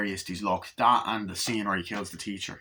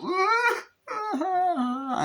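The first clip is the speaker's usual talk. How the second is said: very high imitated shrieks; pained screams. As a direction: shriek as if the hand is cut. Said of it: "and she goes".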